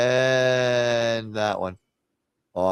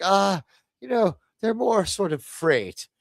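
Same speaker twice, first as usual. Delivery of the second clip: higher, hammed-up fake Irish accent; faked Irish accent; 6 semitones higher than usual. Harry Mudd dismissing his crew, in a mock Irish accent. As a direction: toss it off in a put-on Irish lilt. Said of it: "he's faking an Irish accent".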